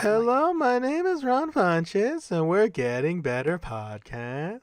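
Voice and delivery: slow, high-pitched podcast host impression; stretched-out high comedian voice; Ron Funches. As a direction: drawl the intro up high, sliding back down. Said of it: "he talks like this all the time".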